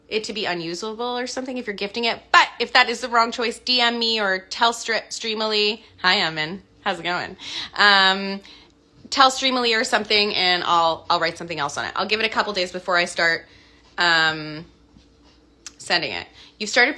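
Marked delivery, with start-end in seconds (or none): none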